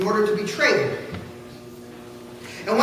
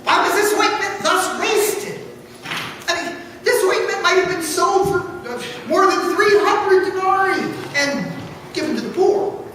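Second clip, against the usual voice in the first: indignant; 6 semitones higher than usual. Acted. Grumbling guests scold the woman over wasted ointment, indignant. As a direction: protest the waste with scorn. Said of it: "said to themselves very indignantly".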